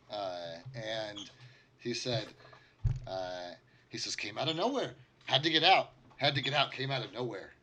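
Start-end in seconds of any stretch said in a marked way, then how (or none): 4.2-7.5 high, loud, haunted repeating; stuck in a loop of terror; frightened repeating. Acted. Survivor starts it again, high and frightened.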